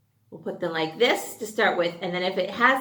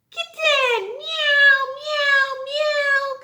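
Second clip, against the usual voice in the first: high, cute meowing; playful kitten meows; 6 dB louder, much slower than usual. Acted. Teacher imitating a kitten for the kids, high meows. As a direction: mew like a tiny kitten.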